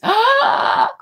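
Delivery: high sudden shocked gasp; sharp gasp. Real, not acted. Shocked at the owl picture.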